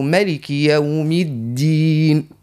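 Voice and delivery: مالك with a short clean kasra, slow; don't stretch مالك; correct vowels. Shown as a demonstration. Teacher gives the correct مالك, slow. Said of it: "لا، نقولوا".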